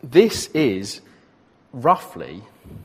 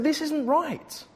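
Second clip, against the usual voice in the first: high, mock-indignant protest; outraged Luther; 14 semitones higher, 7 dB quieter, slower than usual. Preacher voicing Luther protesting indulgences, mock outraged. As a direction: play Luther's indignation, pitched up. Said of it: "Luther was outraged by this".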